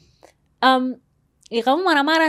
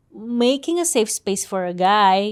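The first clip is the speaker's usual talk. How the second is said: slow and deliberate; slower than usual. Shown as a demonstration. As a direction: draw out each word of "making a safe space for a guy". Repeats the phrase for emphasis.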